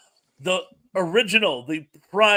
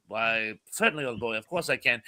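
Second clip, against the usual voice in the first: lower, mock-English old boy accent; impromptu English accent; 7 semitones lower, 7 dB quieter than usual. Actor bluffing an English accent at his audition. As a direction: put on a makeshift English accent. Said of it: "did this impromptu English accent".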